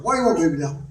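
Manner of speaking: put-on "Why you want, baby doll" in one quick low run; "Why you want, baby doll" low, with no gaps; words run together. Shown as a demonstration. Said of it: "I run mine together".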